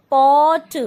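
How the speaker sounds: pot with a long, loud open 'aw'; correct pronunciation. Shown as a demonstration. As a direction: stretch the vowel of pot, release the t. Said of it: "आवाज़ निकालेंगे तो ओ". (pot में खिंची हुई 'ऑ' की आवाज़)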